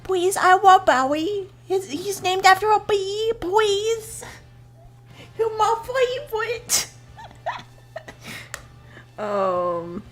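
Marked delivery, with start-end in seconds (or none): 0.0-6.9 high, whiny begging, slow on please; mock pleading. Viewer begging the show to spare Barry, high whiny mock plea. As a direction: beg the screen like a heartbroken kid.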